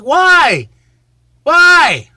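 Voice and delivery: high loud why; exasperated. Real, not acted. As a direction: plead in frustration. Exasperated at repeat matches.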